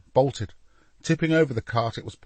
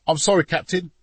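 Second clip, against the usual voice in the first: higher, louder apologetic plea; begging apology; 7 semitones higher, 6 dB louder than usual. A narrator's reading. Soldier to captain after woman got past, begging. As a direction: beg pardon of a superior. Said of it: "begged the soldier".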